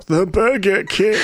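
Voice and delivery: high, slow announcer voice. Pretend. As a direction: announce it like a mascot. Announcing the Burger King.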